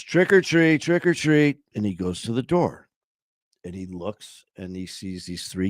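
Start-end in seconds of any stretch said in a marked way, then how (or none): 0.0-1.6 high singsong trick-or-treat call; trick-or-treaters. Acted. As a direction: kids calling out at the door. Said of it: "he hears".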